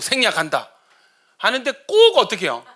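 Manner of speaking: ordinary talk, nothing marked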